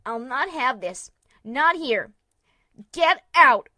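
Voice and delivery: furious, rising to a shout; angry shout. A narrator's reading. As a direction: order him out angrily. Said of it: "pointed an angry hoof".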